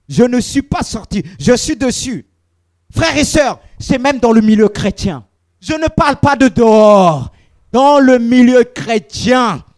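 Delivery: loud, slowing shout on the last line; fervent preacher warning the faithful; preaching at full force. Acted. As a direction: call it out to the church. Preaching to the congregation.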